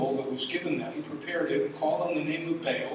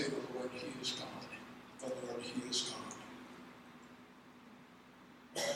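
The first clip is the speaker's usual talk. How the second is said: awed hush; 4 semitones lower, 17 dB quieter, faster than usual. Acted. People awed by the fire.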